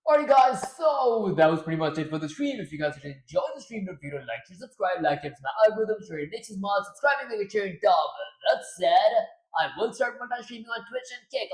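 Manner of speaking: loud, upbeat radio host. Acted. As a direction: announce it like a radio DJ. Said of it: "this does not sound like a radio voice anymore".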